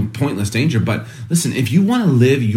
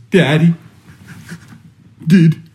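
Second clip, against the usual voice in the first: put-on a little boy's voice, much higher, slow and louder, breaking into laughter; son's voice; 7 semitones higher, 5 dB louder, much slower than usual. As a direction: voice the son asking if dad died, laughing at it. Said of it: "He goes".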